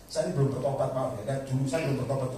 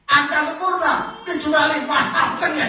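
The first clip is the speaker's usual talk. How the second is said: high, loud mock-aghast retelling; hammed-up shocked exclamation, laughing at the end; exclaimed in dismay; 13 semitones higher, 9 dB louder than usual. Same answer again, preacher aghast.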